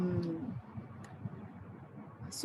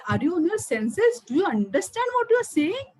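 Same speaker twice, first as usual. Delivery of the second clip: higher, incredulous retold questioning; shocked disbelief; 7 semitones higher than usual. Storyteller as shocked king confronting his wife, incredulous. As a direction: challenge it with rising, acted disbelief.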